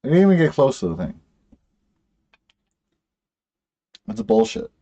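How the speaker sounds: exasperated. Real, not acted. Frustrated at the game glitch.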